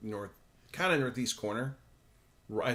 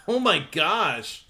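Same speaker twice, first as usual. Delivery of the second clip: laughing; 7 semitones higher, 8 dB louder, much slower than usual. Real, not acted. Laughing at listener's comment.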